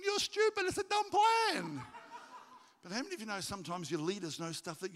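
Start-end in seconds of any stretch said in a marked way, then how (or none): 0.0-1.8 very high, loud comic protest; exasperated mock outburst. Preacher voicing the armor bearer's secret thought, exasperated mock outburst. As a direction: build to a drawn-out high yell.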